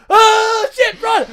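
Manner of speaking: very high, loud acted shriek; panicked scream. Alex sees the minotaur, panicked scream. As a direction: scream it in hammed-up terror.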